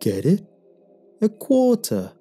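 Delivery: high, loud joking punchline; gloating punchline. A narrator's reading. The moon pushing its pun on the narrator, gloating. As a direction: land the pun, pleased with itself.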